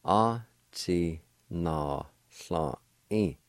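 a ti na sla i, said slowly; slow pronunciation. Shown as a demonstration. Teacher models the second part slowly for students. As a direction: draw out a ti na sla i. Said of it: "The second part".

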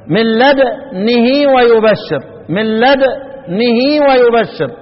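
chanted slowly, a teacher modelling the recitation; Quranic recitation. Shown as a demonstration. Teacher demonstrating recitation to students, slow chanted reading. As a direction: recite "من لدنه ويبشر" slowly, lips rounded on the silent nun then falling into the kasra. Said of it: "ضم الشفتين وانزل على الكسر".

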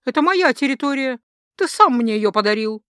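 loud, indignant claim; angry protest. Acted. Goddess defending her territory to the dark god, angry. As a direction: stake your claim loudly and crossly.

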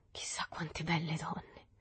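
slow, dreamy whisper; hushed, musing aside; talking to oneself. A narrator's reading. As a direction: whisper it to yourself in wonder. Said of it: "come parlando a se stesso".

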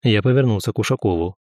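usual speaking voice

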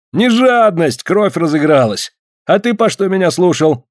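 high, heated retort. Acted. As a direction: snap back defensively.

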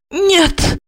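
high, sharp inner cry; anger and horror. A narrator's reading. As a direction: recoil in horror. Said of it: "с гневом и ужасом подумала она".

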